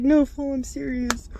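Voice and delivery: loud, high protest, imitating; retold insistence. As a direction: insist loudly that you mean it. Retold neighbour insisting after teasing.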